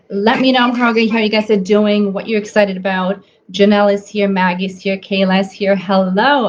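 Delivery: normal talking voice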